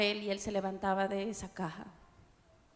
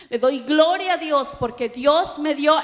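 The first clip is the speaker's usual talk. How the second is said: loud praise; 5 semitones higher, 10 dB louder than usual. Acted. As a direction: proclaim it to the whole church. Preaching climax.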